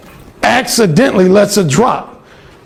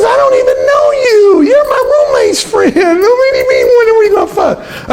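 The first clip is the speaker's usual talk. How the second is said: much higher, shrieking mock woman's voice; outraged roommate; 14 semitones higher than usual. Alan imitating the roommate's outraged protest, very high. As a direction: screech it in disbelief.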